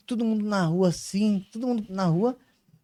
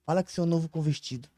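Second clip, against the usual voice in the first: retold inner voice; 3 semitones lower, 8 dB quieter, faster than usual. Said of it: "enquanto a outra falava assim".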